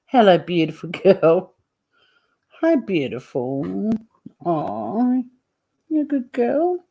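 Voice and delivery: a hint of doting pet-owner coo; baby talk to the cat. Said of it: "But this voice".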